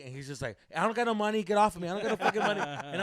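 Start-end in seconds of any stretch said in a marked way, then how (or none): 0.8-2.6 louder, defensive shout of refusal; alarmed brush-off. Retold or imitated. Comedian fending off a sweaty stranger, louder alarmed refusal. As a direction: imitate a startled man pushing a stranger away. Scared comedian shoos him off.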